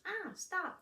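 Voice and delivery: high squeal, mimicking the scream; toy hamster echo. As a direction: parrot a child's scream as a toy. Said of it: "the hamster goes".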